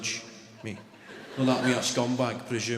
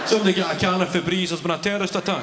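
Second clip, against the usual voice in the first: mock alarm; 5 semitones higher, 5 dB louder, faster than usual. Mock alarm at bad smell.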